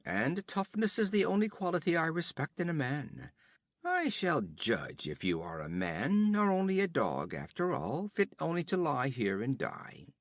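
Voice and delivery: höher und lauter, prahlerisch spöttisch; höhnischer Räuberhauptmann. Acted. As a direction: ein Wüstenräuber verhöhnt den Gefangenen. Olgerd spricht.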